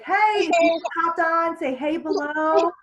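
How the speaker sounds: high, loud, drawn-out welcome; peppy live greeting. Shown as a demonstration. Coach demonstrating how to greet live viewers, peppy. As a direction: call out to viewers as they join.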